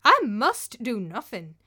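stubborn refusal. Acted. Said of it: "replied Thorgunna".

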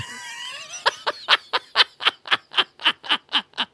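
high squealing burst of laughter; shrieking laugh. Real, not acted. Comedian shrieks with laughter recalling the moment.

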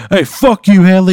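loud, high heckle; defiant shout. Acted. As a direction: shout the insult across a yard. Acted taunt before throwing egg.

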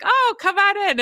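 light high-pitched welcome; high bright invitation. Retold or imitated. Answering a knock at the door, high and bright. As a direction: chirp it out cheerfully. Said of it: "And I was like".

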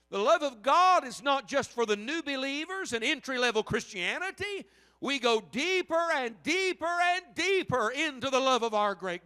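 high, loud pulpit preaching; impassioned preaching. Real, not acted. Preaches at full force.

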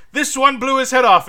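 gleeful jab, tossed off high and loud; mock gleeful quip. Cohost imitating Fraser's balloon joke, hammed-up and high. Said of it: "Fraser keeps popping balloons and being like".

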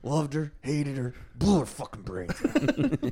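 low, quiet, deliberate menace; Unforgivable guy. Acted. Quoting a video character to friends, low and slow. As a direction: drop low and soft, slowing on the last phrase. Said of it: "Or the Unforgivable guy".

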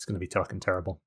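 normal talking voice

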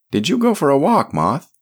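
put-on high, fond voice of an old priest; a kindly priest easing his friend, pitched high and loud; gentle old priest Sébastien. A narrator's reading. Sébastien's voice in the story.

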